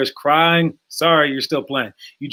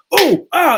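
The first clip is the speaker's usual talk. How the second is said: high, louder startled cries while blocking; startled yelps; 12 semitones higher, 5 dB louder than usual. Retold or imitated. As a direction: yelp like you just caught a strike. Kid blocking a punch.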